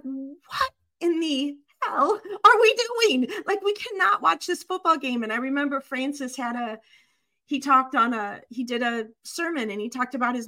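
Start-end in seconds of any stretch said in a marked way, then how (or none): 0.0-5.2 higher-pitched mock disbelief; replayed incredulous objection; retold indignant outburst. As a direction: protest it, acting out an appalled reaction. Acts out her intense past outburst.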